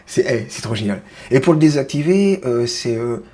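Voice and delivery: ordinary talk, nothing marked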